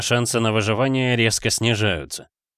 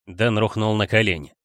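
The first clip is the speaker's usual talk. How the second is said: plain reading voice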